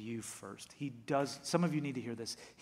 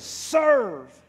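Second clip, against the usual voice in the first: loud proclaimed word "Serve."; called out; 9 semitones higher, 15 dB louder than usual. Shown as a demonstration. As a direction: belt "Serve." like a slogan. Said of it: "What are you here to do?".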